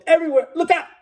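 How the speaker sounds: high, loud panicked yell; terrified yell. Acted. Young sailor warns the others, high terrified yell. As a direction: scream the warning. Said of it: "he yelled".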